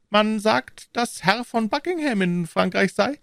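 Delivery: high-pitched, sly insinuation; high, insinuating voice; malicious smile. A narrator's reading. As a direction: drop gossip with a spiteful smile. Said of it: "mit einem hämischen Lächeln".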